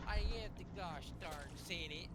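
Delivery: put-on slow, hammy Southern accent; overdone Southern accent, slowly; bad Southern accent. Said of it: "in a bad Southern accent".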